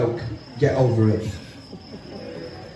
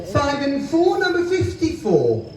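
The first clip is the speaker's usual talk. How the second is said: bingo caller; 5 semitones higher than usual. Acted. Calls bingo numbers.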